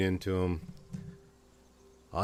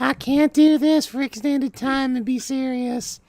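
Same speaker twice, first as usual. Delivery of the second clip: high, loud mock Southern belle; 8 dB louder than usual. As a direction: put on a hammy Southern belle voice. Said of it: "You sound like a Southern belle".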